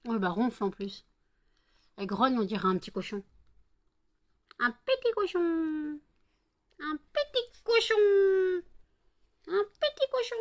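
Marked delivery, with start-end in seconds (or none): 4.6-6.0 voix aiguë de petit cochon. Pretend. Imite le petit cochon grognant. 6.8-10.4 voix de cochonnet suraiguë; petit cochon très aigu. Pretend. Imite le petit cochon, plus aigu.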